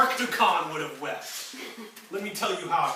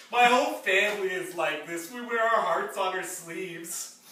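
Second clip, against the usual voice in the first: ordinary delivery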